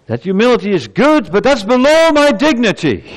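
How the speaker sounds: pompous mock protest rising in pitch; mock indignant objection. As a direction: play the offended disciple. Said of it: "an objection arising in the minds of his disciples".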